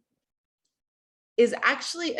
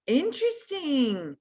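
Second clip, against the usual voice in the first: intrigued; 6 semitones higher, slower than usual. Real, not acted. Teacher surprised by the show of hands.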